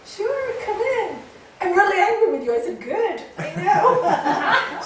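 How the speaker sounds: high, loud, slow, laughing; laughing reply. Retold or imitated. Teacher welcoming the angry student, laughing and loud. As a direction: answer cheerfully through a laugh.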